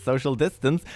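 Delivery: ordinary delivery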